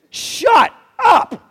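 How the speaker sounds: high, emphatic shout; loud, indignant rebuke; exasperated outburst. Real, not acted. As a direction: shout down the nonsense, each word apart. Rebukes false teaching he mimicked.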